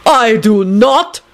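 put-on high, drawn-out accented denial; outraged shout. A narrator's reading. Dragomir accused of cheating, outraged. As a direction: protest in slow outrage. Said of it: "Dragomir shouted with an outraged expression".

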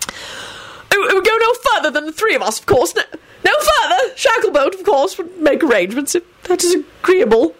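high, panicky stammering; frantic stammer. A narrator's reading. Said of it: "Fudge stammered out frantically".

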